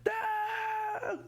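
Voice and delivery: high desperate shrieking no; distant anguished feminine scream; agonized scream. A narrator's reading. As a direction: scream for your life, far behind a door. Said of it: "someone screaming".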